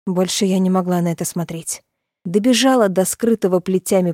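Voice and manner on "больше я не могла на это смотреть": usual speaking voice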